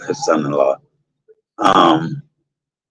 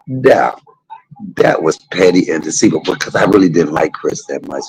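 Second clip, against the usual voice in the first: ordinary talk, nothing marked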